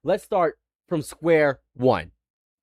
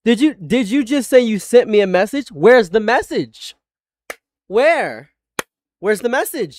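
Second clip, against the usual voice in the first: loud, raised, incredulous demand; angry interrogation; 5 semitones higher, 7 dB louder than usual. Real, not acted. Streamer demands the message caller claims he sent, angrily. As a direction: press hard, high and loud.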